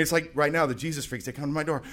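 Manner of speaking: ordinary talk, nothing marked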